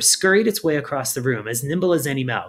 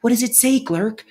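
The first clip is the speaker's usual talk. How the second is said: high, louder pestering; eager pestering; 10 semitones higher, 3 dB louder than usual. A narrator's reading. Firion pestering Glurk about the note, high and eager. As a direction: buzz around him demanding.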